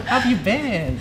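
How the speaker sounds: bright, upbeat service-desk greeting; customer service voice. Acted. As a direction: greet them like a cheerful cashier. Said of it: "that was, like, top tier custom".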